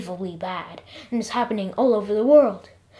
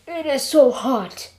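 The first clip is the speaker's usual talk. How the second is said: drawn-out, higher groan; groaning complaint; 4 semitones higher, 6 dB louder, slower than usual. A narrator's reading. Jeff swelters in tremendous heat, groaning. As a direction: groan it out in discomfort. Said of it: "I groaned".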